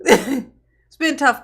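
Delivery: slow, laughing through the words; mock lament dissolving into laughter. Mocking the defendant's hard year.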